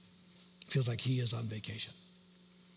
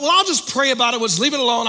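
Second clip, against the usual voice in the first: evasive excuse; 12 semitones higher, 13 dB louder, faster than usual. Retold or imitated. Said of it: "I just wanted to run from it".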